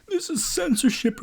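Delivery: high, whiny mocking voice with a laugh; mock whiny critic. Mocking the critics who cry censorship, in a high whiny voice. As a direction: whine it like an offended crybaby.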